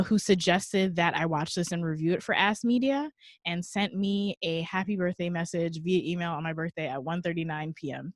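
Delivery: ordinary talk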